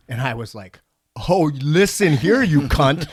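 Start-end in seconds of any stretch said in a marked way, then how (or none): 1.3-3.1 louder, slow, higher threatening comeback; slow, loud retold comeback at the rude driver; retold angry comeback. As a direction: square up and hit back hard. Retold quarrel, his comeback.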